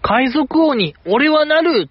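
a slow, high heroic cry with no real shout; Luffy's catchphrase. Retold or imitated. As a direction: declare it like a young pirate captain, lightly hammed. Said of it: "ワンピースのあの第一巻".

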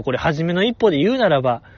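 usual speaking voice

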